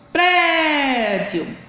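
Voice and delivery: "Prédio" with a long, high PRÉ; teacher's PRÉÉÉ-dio stress demo; stressed syllable drawn out. Shown as a demonstration. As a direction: stretch the first syllable of "Prédio", then let it fall. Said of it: "pronunciado com força".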